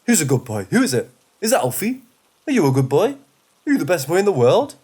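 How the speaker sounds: talking to a pet; good boy voice. A narrator's reading. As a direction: coo questions at a beloved dog, pitch swooping up. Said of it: "in my best good boy voice".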